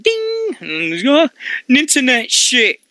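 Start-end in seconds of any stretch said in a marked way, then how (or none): 0.0-0.6 very high sung ding; phone ding. Retold or imitated. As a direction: chime like a notification. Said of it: "I'm like".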